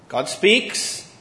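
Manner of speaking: ordinary delivery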